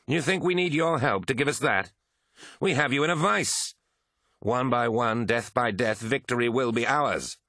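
high, forceful scornful boast; Eidolon's derision. A narrator's reading. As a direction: ring out each threat with scornful confidence. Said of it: "laughed Eidolon".

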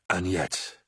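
mild, held-in rage; choking back rage. A narrator's reading. Horus to Aximand, choking back his rage. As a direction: hold the anger down, voice controlled. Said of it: "choking back his rage".

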